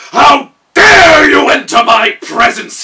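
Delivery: roaring high and very loud, slow; furious shout. Acted. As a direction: bellow with royal rage. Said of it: "he shouted".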